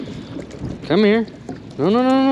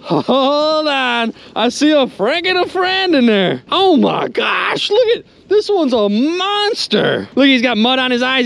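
ordinary delivery

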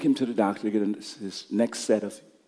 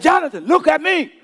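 put-on high, raised-voice plea to a child; a father calling his son's eyes to him; urgent command; 7 dB louder than usual. Father calling his panicking son.

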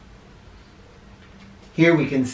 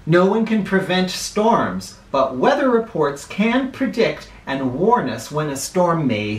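clear, measured read-aloud for a class; reading to children; 5 semitones higher than usual. Acted. Teacher reading a weather book to her class, read-aloud style. Reads closing page aloud.

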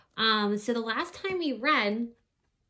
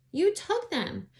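accusing; 11 semitones higher, slower than usual. Acted. Miranda accuses Abby.

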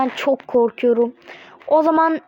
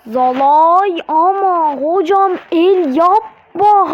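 loud; 3 semitones higher, 6 dB louder, much slower than usual. Real, not acted. Complaining at referee.

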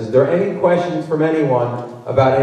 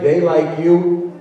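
usual speaking voice

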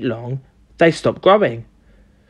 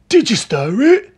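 high, excited question; eager cry; 6 semitones higher than usual. A narrator's reading. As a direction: ask it urgently in a raised character voice. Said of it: "cried Mister Cranky".

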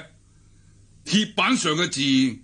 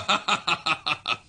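hearty laugh; 2 semitones higher than usual. Acted. Ren laughs proudly.

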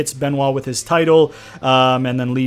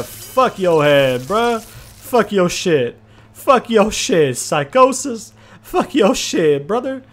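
slow, drawn-out gloating chant, higher toward the end; mock wrestler trash talk; 5 semitones higher, 3 dB louder, slower than usual. Gamer gloating at beaten opponent, slow drawn-out trash talk.

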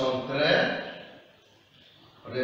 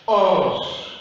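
"onze" louder with a falling finish; model word; 2 semitones higher, 6 dB louder than usual. Shown as a demonstration. Teacher gives pupils the full word onze. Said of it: "Qui nous donne".

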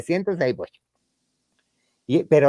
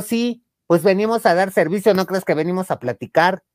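mock blunt retort; 6 semitones higher, 3 dB louder than usual. Sex worker answering bluntly.

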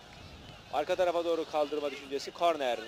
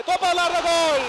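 screaming goal call; 14 semitones higher, 12 dB louder than usual. Real, not acted.

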